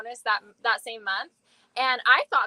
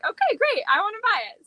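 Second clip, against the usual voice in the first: excited; 9 semitones higher, 4 dB louder than usual. Retold or imitated. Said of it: "and then they say".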